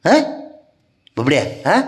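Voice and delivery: ordinary delivery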